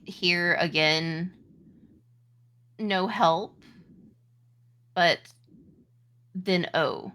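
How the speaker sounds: usual speaking voice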